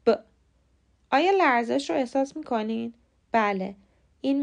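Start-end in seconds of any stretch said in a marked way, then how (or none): 0.0-0.2 ب said as a bare buzzing b; a short ب with the throat vibrating; voiced b. Shown as a demonstration. Teacher asking listeners to feel their throat on ب. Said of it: "حرف ب رو تلفظ کنید".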